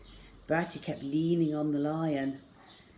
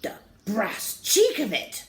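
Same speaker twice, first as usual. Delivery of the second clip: slow, louder, higher indignant colonel; spluttering outrage; 5 semitones higher, 4 dB louder than usual. A narrator's reading. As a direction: splutter at the cheek of it. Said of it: "the colonel spluttered".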